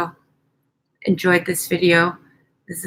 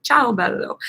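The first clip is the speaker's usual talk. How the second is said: mock Italian; 4 semitones higher than usual. Playful Italian sign-off.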